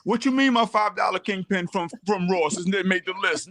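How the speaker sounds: high, put-on voice of an indignant fan; mock offended commenter. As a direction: mimic a fan protesting his cheap toy. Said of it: "somebody's like".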